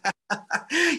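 bright rising laugh; short amused laugh. Real, not acted. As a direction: burst out laughing at the joke. Laughs at host's joke.

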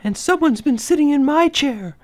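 medium-sized bear voice, pitched well up; indignant mother bear, high; high storybook she-bear; Mama Bear. A narrator's reading. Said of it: "said in her medium-sized voice".

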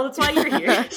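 breaking into giggles; can't stop the giggles; giggling. Real, not acted. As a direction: laugh through the line. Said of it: "[name]'s got the giggles".

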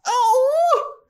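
piercingly high mock tantrum wail; Harmony. Girlfriend whining to her vampire boyfriend about France, hammed-up falsetto tantrum. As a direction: whine way up in falsetto, loud and hammed-up.

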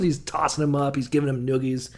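ordinary talk, nothing marked

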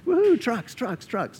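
high whooping cheer over the trucks; mock cheer. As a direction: whoop like you just won a prize. Cheering his imagined reward.

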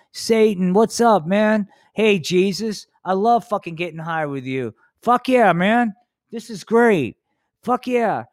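giddy high-pitched stoner cheer; stoned buddies. Acted. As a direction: whoop it up with a pal. Satan and Jesus getting high.

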